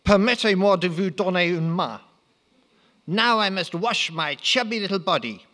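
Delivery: put-on high comic French accent. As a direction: ham up the Frenchman, pitched high. Napoleon voiced in parody.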